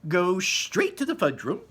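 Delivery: high, loud slow order; high loud Wonka. Acted. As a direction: send him off. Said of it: "Mister Wonka said".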